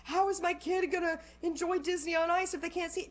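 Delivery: high-pitched mock parental complaint; fretting parent. Parent doubting Disney on Ice, high mock fretting. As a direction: imitate a parent making a fuss.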